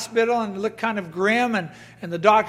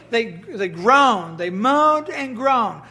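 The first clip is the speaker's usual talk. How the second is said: slow, dragged groaning words; slow mock moan; drawn-out groan; much slower than usual. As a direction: stretch them out like a grumble. Mocking Israelite complaint.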